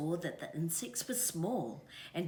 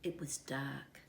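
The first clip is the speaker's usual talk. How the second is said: plain reading voice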